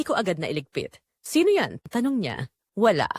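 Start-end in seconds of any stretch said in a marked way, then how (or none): 1.2-1.8 high, loud suspicious question; a sudden jealous challenge; sharp demand. Acted. Jealous lover demands answer. 2.7-3.1 quick denial. Acted. She brushes off question.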